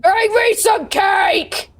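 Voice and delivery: slow, high bellowing giant voice; giant's demanding bellow. Acted. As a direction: roar it out like a greedy giant.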